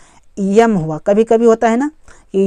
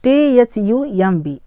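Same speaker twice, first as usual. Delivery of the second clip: slow letter-by-letter spelling; spaced-out letters; slower than usual. Shown as a demonstration. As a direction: spell it slowly for learners. Spelling a word aloud for learners.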